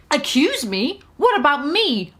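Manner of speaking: plain reading voice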